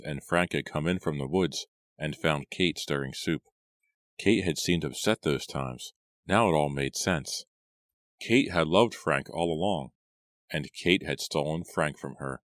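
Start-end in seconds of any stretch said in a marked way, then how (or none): none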